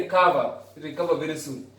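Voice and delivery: soft get-well wish to someone sick; tender get-well wish. Retold or imitated. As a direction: gently wish a quick recovery. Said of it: "You say".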